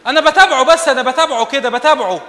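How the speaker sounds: high, loud mock girl insisting; mock girl's excuse. Preacher imitates a girl defending her chatting, high and loud. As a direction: play the naive girl, laughing.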